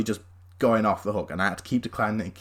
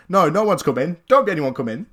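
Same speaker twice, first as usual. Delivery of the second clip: louder, higher, tripping over words; flustered drunk denial; 5 semitones higher, 6 dB louder than usual. Retold or imitated. Drunk intruder backpedalling to the teen, louder and flustered. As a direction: backtrack clumsily like a caught drunk. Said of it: "intruder backpedals".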